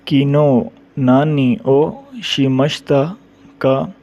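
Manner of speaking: किनो नानी ओ शिमश्ता का drawn out slowly; slow demo. Shown as a demonstration. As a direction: lay out किनो नानी ओ शिमश्ता का word by word for learners. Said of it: "teacher models past question slowly". (किनो नानी ओ शिमश्ता का धीरे-धीरे)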